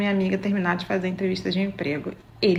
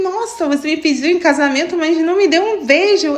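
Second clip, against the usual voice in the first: retold outrage, pitched higher and louder; indignant complaint; 5 semitones higher, 4 dB louder than usual. After the date, she protests that he never kissed her, indignant. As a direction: throw the complaint back at him, indignant. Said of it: "eu fiquei tão revoltada que eu falei".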